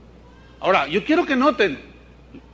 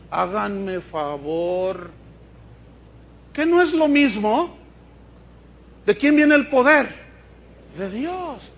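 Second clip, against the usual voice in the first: ordinary talk, nothing marked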